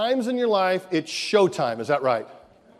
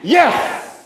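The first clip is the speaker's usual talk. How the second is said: loud high cheer; excited shout; 8 semitones higher, 6 dB louder than usual. Shown as a demonstration. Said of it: "Yes, like that".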